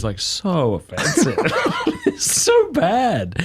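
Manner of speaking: slow, laugh-filled words; real laughter pushing the voice up high. Real, not acted. Laughs about dad's offensive jokes.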